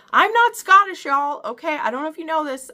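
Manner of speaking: exasperated burst at her commenters; worked up. Real, not acted. Vlogger answers commenters on her pronunciation, worked up and loud. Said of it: "I'm probably more worked up about it".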